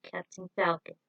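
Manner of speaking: ordinary delivery, nothing marked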